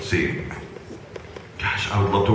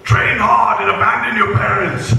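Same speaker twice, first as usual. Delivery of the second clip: Vegeta voice; 14 semitones higher, 6 dB louder than usual. Acted. Vegeta voice advising the baby.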